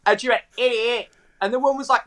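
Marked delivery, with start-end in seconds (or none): 0.6-1.1 "Idiot" as a Northern Irish "eighty-eight"; imitated Northern Irish vowels; Northern Irish accent. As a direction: mimic a Northern Irish girlfriend giving her birth year. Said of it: "is from Northern Ireland".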